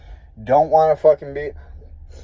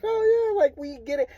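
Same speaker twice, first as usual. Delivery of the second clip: much higher, airy mock nurse voice; 14 semitones higher than usual. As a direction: parody her dismissive agreement. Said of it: "And she's like".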